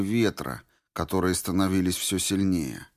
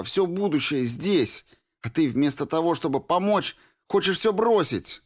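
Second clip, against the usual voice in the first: begging Norris; 10 semitones higher than usual. A narrator's reading. Girl begging the deserter to leave, higher and urgent. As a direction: beg him to flee with you.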